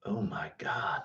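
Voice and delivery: hushed, voiceless realization; whispered amazement. Pretend. Streamer realizing he is quarter giant, whispered. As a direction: whisper it in mock awe.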